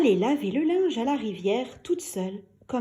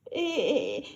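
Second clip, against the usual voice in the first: sobbing; 7 semitones higher than usual. A narrator's reading. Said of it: "sanglote M Toto".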